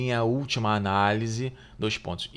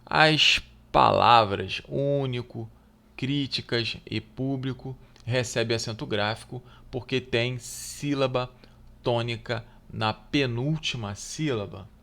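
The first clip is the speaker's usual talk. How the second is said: louder, deliberate read of the test question; reading the exam item; 5 dB louder than usual. Acted. Teacher reading the exam question aloud to students, deliberately. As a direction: read the question out clearly to the class.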